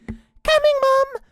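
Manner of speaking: shouted. Acted. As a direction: call out eagerly to the door. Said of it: "shouted Jenny".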